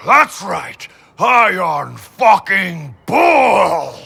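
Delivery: slow, loud, high boast; triumphant shout. Acted. Bull slowly shouting his own name in triumph over the demon. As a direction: roar your own name in victory. Triumphant self-cheer in exercise.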